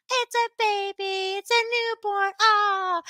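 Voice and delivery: put-on high, drawn-out baby-admiring coo; imitating cooing listeners. Students hearing baby cries, imitated high cooing. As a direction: gush over a newborn, in imitation. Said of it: "they're like".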